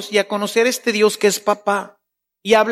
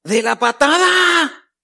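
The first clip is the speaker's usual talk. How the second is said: hurt complaint to God; complaining prayer; 8 semitones higher than usual. Shown as a demonstration. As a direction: reproach your dad for not showing up. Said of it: "A quejarnos".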